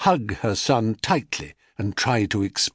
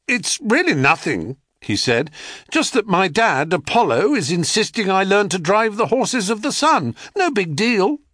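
a high, puffed-up lie tossed off casually; boastful boy; 10 semitones higher than usual. A narrator's reading. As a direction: show off, pretending it's nothing. Boy brags to the crowd.

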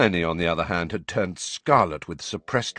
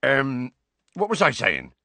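hesitant, higher-pitched muddle; Hagrid, slightly flustered; 5 semitones higher than usual. A narrator's reading. Teacher losing his thread before the class, slightly flustered. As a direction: lose the thread and grope for it. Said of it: "looking slightly flustered".

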